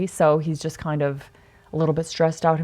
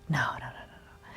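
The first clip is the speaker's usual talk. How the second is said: soft denial; 6 semitones lower, 13 dB quieter than usual. Retold or imitated. Reassuring a sad crew member.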